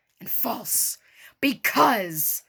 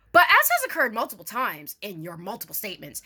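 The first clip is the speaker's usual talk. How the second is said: ordinary talk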